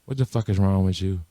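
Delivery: quiet scolding. Retold or imitated. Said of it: "He's like".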